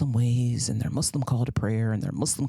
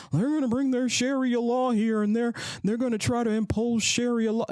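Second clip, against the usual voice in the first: higher, whiny voice of a panicked critic; higher mocking voice of a worried neighbour; mock alarmed critic; 10 semitones higher than usual. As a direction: ham up the Sharia fearmonger. Mocking fearful critics' claim.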